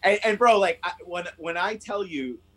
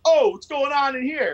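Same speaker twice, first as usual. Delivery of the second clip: higher, louder mock intruder; intruder asking; 9 semitones higher, 6 dB louder than usual. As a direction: play the surprised stranger walking in. Intruder voiced in retold story.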